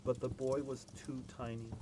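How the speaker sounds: usual speaking voice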